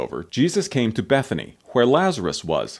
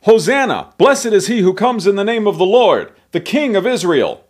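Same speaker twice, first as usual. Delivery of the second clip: loud, high-pitched acclamation; crying out; 10 semitones higher, 8 dB louder than usual. A narrator's reading. Crowd greeting Jesus on the road to Jerusalem, crying out. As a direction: ring it out like a crowd hailing a king. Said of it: "cried out".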